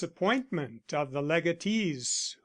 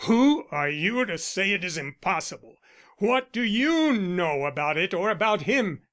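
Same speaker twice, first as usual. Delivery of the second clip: louder, rising angry challenge; fierce; 3 semitones higher, 5 dB louder than usual. A narrator's reading. Arnold turns on Elsie, loud fierce challenge. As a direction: lash out at her, jealous and stung. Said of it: "He turned on her fiercely".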